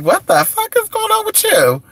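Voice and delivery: high, shocked mom yelling; imitating mom's shocked outburst. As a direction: squeal it like an outraged mom. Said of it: "my mom was like".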